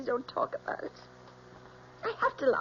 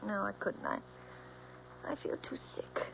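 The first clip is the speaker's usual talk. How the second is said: faint, sick voice; 11 dB quieter than usual. Acted. Nervous daughter before inquest.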